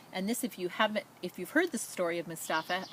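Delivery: usual speaking voice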